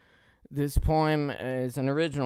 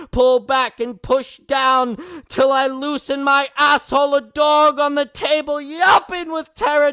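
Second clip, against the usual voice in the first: impassioned open-mic poem reading, swelling to shouts; spoken-word poet pleading, building to cries; poetry performance; 13 dB louder than usual. Acted. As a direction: pour the poem out like a plea, rising and falling.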